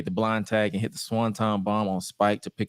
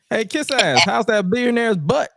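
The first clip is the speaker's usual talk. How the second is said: high, louder jeering voice; mocking taunt; 11 semitones higher, 6 dB louder than usual. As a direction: jeer at him like a schoolyard bully. APA taunting Regal.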